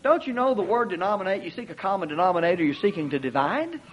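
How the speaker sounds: ordinary delivery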